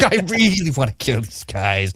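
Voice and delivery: slow, lightly voiced British nobleman bent on killing; a hint of a bored, menacing British prince; mock Matt Smith drawl, slow and sly; low T Damon voice. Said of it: "you do it perfectly, [name]. Low T, low T British man".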